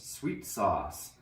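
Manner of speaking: ordinary delivery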